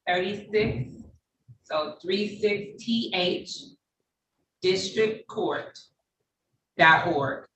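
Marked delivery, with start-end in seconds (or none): 2.0-7.4 slow, chunked dictation with pauses between parts. Real, not acted. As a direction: spell it out slowly, piece by piece. Dictating website to someone writing it.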